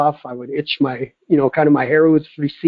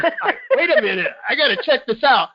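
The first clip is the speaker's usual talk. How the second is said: high, laughing retold disbelief; amused mock bewilderment; disbelieving; 9 semitones higher than usual. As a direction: imitate a flustered doctor through laughter. Retelling doctor's disbelief while laughing.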